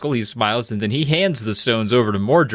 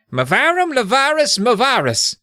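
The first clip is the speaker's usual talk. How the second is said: very high theatrical spell-casting; hammed-up incantation, pitched way up; magic incantation; 11 semitones higher than usual. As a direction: proclaim the spell in a put-on wizard voice.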